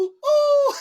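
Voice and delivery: high, loud drawn-out hoot; imitated hooting call. Recalling a funny catchphrase from the show, imitated hooting call. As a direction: call out a loud, soaring "ooh". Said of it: "it was either hooty hoo or ooh".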